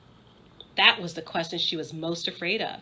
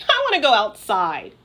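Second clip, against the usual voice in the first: whining; 8 dB louder than usual. Acted. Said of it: "wailed Megan".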